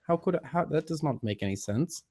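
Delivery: ordinary talk, nothing marked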